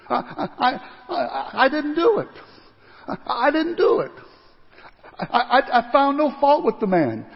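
high, stammering, nervous laughs; frantic denial. Acted. Pilate stammering he is innocent. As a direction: sputter your innocence.

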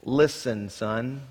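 low, soft mock father; lightly voiced parent warning his son; a hint of a stern parent. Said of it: "your parents have said".

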